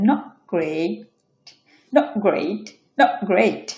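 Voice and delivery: "not great" as nog, a catch, then great; glottal stop. Shown as a demonstration. Teacher models a bump, "not great" with swallowed T. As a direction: check the T of "not great" in the throat. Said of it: "একটা ধাক্কা খেয়ে পরে উচ্চারণ হচ্ছে".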